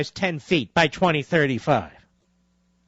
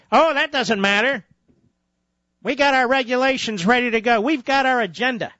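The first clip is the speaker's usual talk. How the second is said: higher-pitched mock of agenda pushers; mocking dismissiveness; 6 semitones higher than usual. Host voicing regulators who ignore the science, mocking dismissiveness. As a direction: brush it off as the blithe regulator.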